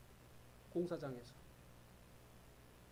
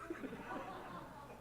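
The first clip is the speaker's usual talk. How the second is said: high soft laugh; 10 semitones higher, 9 dB quieter than usual. Real, not acted. Preacher laughs at own joke.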